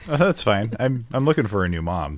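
usual speaking voice

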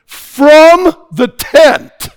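booming. Acted. Same phrase repeated, now booming.

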